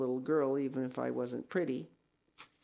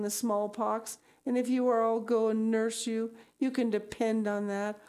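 higher, earnest, anxious voice; pleading; 6 semitones higher than usual. Acted. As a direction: plead with a friend, ending on a high worried question. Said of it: "In vain Anne pleaded".

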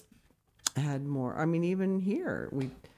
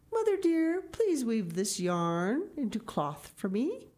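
put-on high, sweet little boy voice, much higher; a small boy pleading sweetly with his mother; little boy asking; 8 semitones higher, slower than usual. Pelle the little boy asks his mother, high and sweet.

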